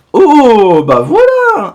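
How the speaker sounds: loud, high-pitched cry of delight; delighted surprise. Real, not acted. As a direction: burst out at a lucky pull. Découvre la carte recherchée. (exclamation de joie)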